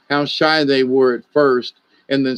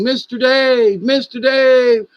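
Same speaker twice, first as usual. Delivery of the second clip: much higher, slow, drawn-out calling of the name; screaming across the field; 11 semitones higher, slower than usual. Retold or imitated. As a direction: imitate a girl yelling his name from far across a field. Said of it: "this young lady is screaming".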